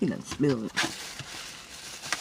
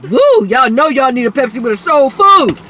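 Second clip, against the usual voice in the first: loud, hyped-up shout to the viewers; 3 semitones higher, 10 dB louder than usual. Real, not acted. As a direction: pump the viewers up like an ad. Hyped up drink plug.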